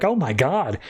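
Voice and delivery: normal talking voice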